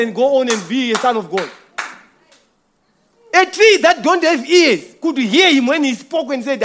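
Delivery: loud, rising sermon cry; full force preaching. Acted. Pastor preaching to the brothers at full force. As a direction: preach it out, hammering each line.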